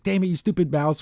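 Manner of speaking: ordinary talk, nothing marked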